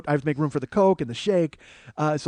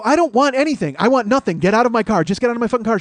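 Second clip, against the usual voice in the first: louder, higher insistence; firm yell; 6 semitones higher, 5 dB louder than usual. Retold or imitated. Refusing the woman's offer, louder and higher. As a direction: push her out with your voice. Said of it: "And I go".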